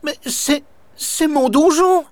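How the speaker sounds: high stammer rising in alarm; stammering ghost. A narrator's reading. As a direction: stutter out the protest, shocked. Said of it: "Grégoire frémit et balbutie".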